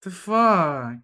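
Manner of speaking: ordinary delivery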